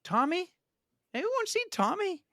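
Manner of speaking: high singsong questioning call; calling for child. Retold or imitated. Parent hunting round the house for Tommy, high-pitched calling.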